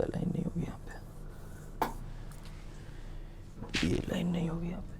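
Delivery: hushed self-correction under the breath; soft whispered aside while fixing a mistake; whispering to himself. Real, not acted. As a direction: whisper it as if thinking aloud.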